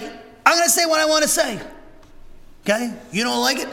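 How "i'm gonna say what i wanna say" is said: defiant declaration. Retold or imitated. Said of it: "And it's like".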